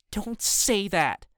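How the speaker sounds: high, drawn-out indignant protest; scold, stretching the words in upset; mad. A narrator's reading. June sides with Mom against Dad, mad. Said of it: "just as mad".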